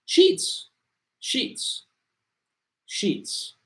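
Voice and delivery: "Sheets" said alone as a careful model; pronunciation demo. Shown as a demonstration. Teacher showing a student how to pronounce it, careful "Sheets". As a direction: say "Sheets" with a long ee and crisp final ts. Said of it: "cómo se pronuncia la palabra sheets".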